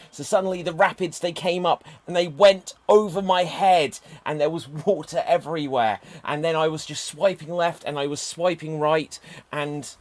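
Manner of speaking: loud, high, hammed-up telling; over-the-top dramatic monologue. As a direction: belt it out like a melodrama. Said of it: "I will now have thirty seconds to recreate".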